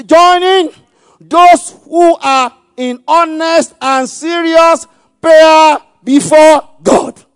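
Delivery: high, very loud, drawn-out words; full-force preaching shout. Real, not acted. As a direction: call it out slowly to the congregation. Preacher shouts verse for repetition.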